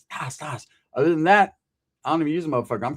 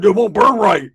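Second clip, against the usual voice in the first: loud protest; 2 semitones higher, 10 dB louder than usual. Retold or imitated. Roach protesting in the car.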